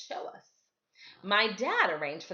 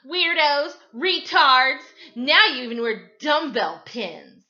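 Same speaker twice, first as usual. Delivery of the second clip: loud jeering insults; bully yelling names at the kids; mean taunt; 6 semitones higher, 11 dB louder than usual. A narrator's reading. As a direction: schoolyard bully shouting insults.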